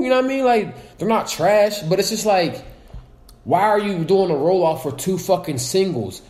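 ordinary talk, nothing marked

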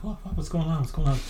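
high, puzzled what-what-what; a mild high confused question. Retold or imitated. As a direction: sputter in surprise. Confused coach asks what happened.

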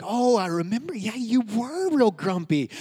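teasing put-on audience voice; knowing listeners. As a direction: imitate the congregation chiming in, stretching it out. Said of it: "Some of you are like".

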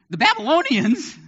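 put-on high, disbelieving "The Babylonians?"; can't believe what God said; incredulous. Habakkuk's incredulous response.

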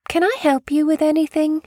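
high-pitched British lady voice; British accent. Retold or imitated. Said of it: "she did it with a British accent".

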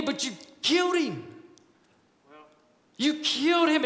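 rising, stricken repeat; pleading accusation. Acted. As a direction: drive it home with grief. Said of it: "he's, like, pleading with them".

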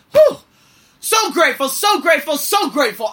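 high, bright repeated thanks; elated thanks; joyful gratitude. Real, not acted. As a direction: gush with happiness.

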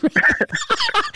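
a burst of high, breathy laughter; real unvoiced giggle. Real, not acted. Big laugh after swearing.

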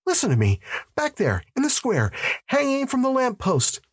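high, climbing urgency; frantic high-pitched insistence; desperate pleading. Acted. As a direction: make them listen, pitch rising to a peak. Desperate man pleading with police.

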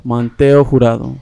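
Manteo Jurado in Spanish sounds; Spanish accent. Shown as a demonstration. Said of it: "second son name".

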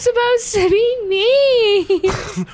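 loud, high drawn-out mock-whine; playfully distraught high cry; mock wail. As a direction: wail in protest, stretching it out slowly. Player wails protest at badger.

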